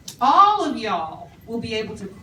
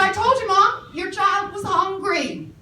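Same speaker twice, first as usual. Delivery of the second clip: higher, insistent retelling to the mom; emphatic told you; 8 semitones higher, 4 dB louder than usual. Retold or imitated. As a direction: press the point, half laughing. Retelling what she told Mom.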